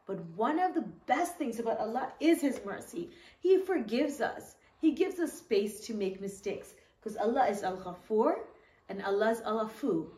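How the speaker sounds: ordinary delivery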